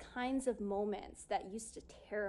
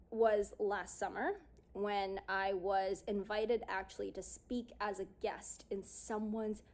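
ordinary delivery

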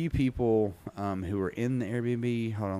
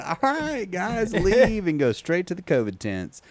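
high-pitched joking send-off to the athletes; comic put-on voice giving orders; mock organizer; 10 semitones higher, 5 dB louder than usual. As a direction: play-act shooing everyone away, voice pitched way up. Mock orders to athletes after the heat.

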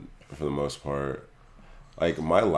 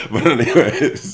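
laughing; 10 semitones higher, 12 dB louder than usual. Real, not acted. Laughing at own lameness.

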